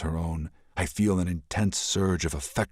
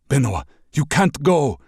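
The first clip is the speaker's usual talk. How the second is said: louder, urgent begging; pleading; 9 semitones higher, 7 dB louder than usual. Acted. Prince begs the princess to stay, urgent. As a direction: beg her not to go.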